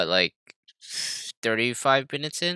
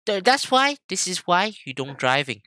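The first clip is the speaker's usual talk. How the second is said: imitating mom scolding; 10 semitones higher than usual. Mimicking mom lecturing about driving, high ranting voice. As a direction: rant like an exasperated mom. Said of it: "she's like".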